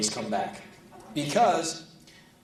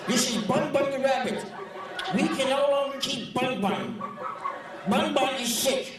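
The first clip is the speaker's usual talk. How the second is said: crazy woman voice; 11 semitones higher than usual. Retold or imitated. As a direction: mimic a dotty pet owner. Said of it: "this woman talked like this".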